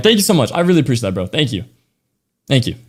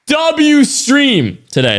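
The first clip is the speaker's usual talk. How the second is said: drawn-out yell, much higher and slow; hype yell; 13 semitones higher, much slower than usual. Real, not acted. Streamer hyping chat over a record stream, slow high yell. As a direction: belt it out to celebrate the stream. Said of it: "That's the loudest I'm gonna yell".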